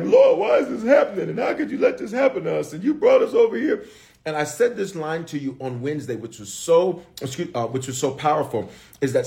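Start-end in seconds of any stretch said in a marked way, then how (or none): 0.0-3.9 high pleading cry to the Lord; desperate Joshua. Retold or imitated. Joshua praying after the lost battle, high anguished plea. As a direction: plead with God like Joshua face down. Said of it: "He's like".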